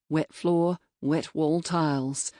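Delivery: usual speaking voice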